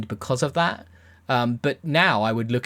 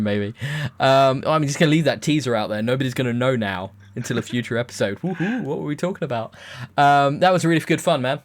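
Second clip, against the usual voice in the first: ordinary talk, nothing marked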